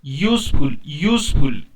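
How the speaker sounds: "यूजफुल" loud and distinct, starting on a y-glide; pronunciation demo. Shown as a demonstration. Teacher telling pupils to listen to the sound, emphatic "यूजफुल". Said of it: "आवाज को ध्यान से सुनिए".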